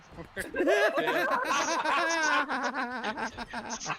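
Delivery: loud, high, long cackling laugh; big laugh. Real, not acted. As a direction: crack up helplessly at your own joke. Cracking up at Tropic Thunder thought.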